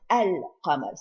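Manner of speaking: "al-qamar" with a clearly sounded l; lam pronounced. Shown as a demonstration. Teacher demonstrating a pronounced lam, "al-qamar" with its l sounded. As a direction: let the l of "al-qamar" be heard. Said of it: "so it is pronounced".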